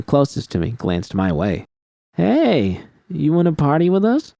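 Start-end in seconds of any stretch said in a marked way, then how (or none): 2.1-4.3 lightly voiced, higher party girl. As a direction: coax her like a woman high on dust. High woman offers drugs at bar.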